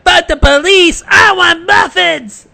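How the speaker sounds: high, slow, whiny shout for muffins. Retold or imitated. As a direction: wail it like a spoiled kid demanding muffins. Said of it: "Derby has went".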